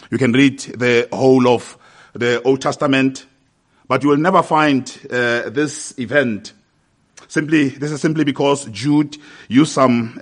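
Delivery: ordinary talk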